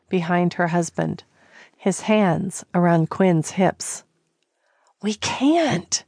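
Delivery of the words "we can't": higher breathy whispered protest; whispered moan. Acted. Callie whispering a weak protest to husband Quinn. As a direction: moan it, barely resisting. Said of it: "she moaned".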